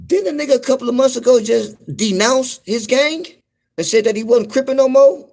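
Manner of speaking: can you believe this; incredulous. Real, not acted. Incredulous about [name].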